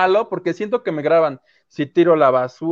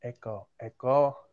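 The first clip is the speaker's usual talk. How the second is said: slow fading echo, softer each time; digital echo; 7 semitones lower, 9 dB quieter, much slower than usual. Pretend. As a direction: mimic a call echoing back. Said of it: "todavía los escucho con eco".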